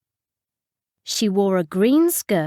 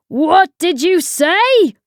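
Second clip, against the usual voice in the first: loud, high, slow challenge; outraged bellow; 9 semitones higher, 6 dB louder, slower than usual. A narrator's reading. Tea lady to a complaining customer, outraged bellow. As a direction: bellow each word slowly in outrage.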